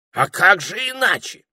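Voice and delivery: high, loud comeback; retorting. A narrator's reading. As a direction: object as if it were obvious. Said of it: "возразил попугай".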